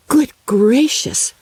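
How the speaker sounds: put-on young girl's startled cry of amazement; excited cry. A narrator's reading. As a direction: gasp it out in wonder, slowly. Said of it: "cried Maria".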